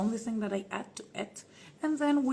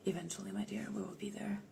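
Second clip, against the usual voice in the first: soft whisper; 10 dB quieter, faster than usual. Acted. Promising fans in whisper.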